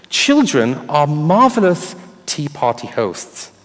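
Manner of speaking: normal talking voice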